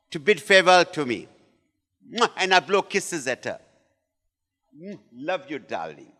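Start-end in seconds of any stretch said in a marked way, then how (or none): 2.1-2.3 blown kiss. Shown as a demonstration. Husband blowing kisses at wife, demonstrated kiss. 4.8-6.1 soft, low endearment; tender farewell. Shown as a demonstration. Husband leaving home to wife at the door, soft tender farewell.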